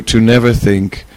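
ordinary talk

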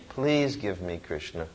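hushed, longing plea to the devotee; soft prayer. Acted. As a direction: beg for Krishna softly.

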